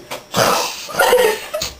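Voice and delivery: high attempted elephant trumpet; badly faked elephant noise. Playing animal noises as an elephant, trumpeting. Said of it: "an elephant".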